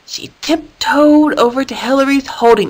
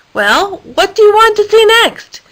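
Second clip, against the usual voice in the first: high, louder, impatient question; Danielle's eager voice; 11 semitones higher, 4 dB louder than usual. A narrator's reading. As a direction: push for an answer, bouncing with impatience. Danielle voiced, asking again.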